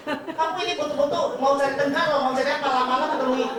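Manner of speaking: high-pitched retold outrage; mock angry. Friend compared unfavourably to a chicken, mock angry. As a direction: act out a friend picking up the fight. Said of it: "Dia marah lagi".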